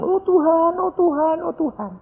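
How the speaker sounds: high, awed cries imitating a crowd. Preacher imitates everyone answering the supposed Jesus, awed high cries. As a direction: mimic everyone crying out in a high voice.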